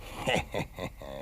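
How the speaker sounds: quiet low chuckle under the breath; muted scheming chuckle; soft chuckle. Acted. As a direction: chuckle softly to yourself. Hunter chuckles to himself.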